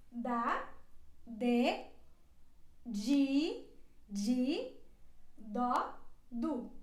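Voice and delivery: da de dó du with a clear d, one syllable at a time; syllable drill. Shown as a demonstration. Teacher drills the d syllables for learners. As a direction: drill each d syllable for repetition. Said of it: "ela se pronuncia assim".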